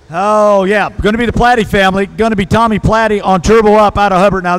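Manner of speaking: ordinary delivery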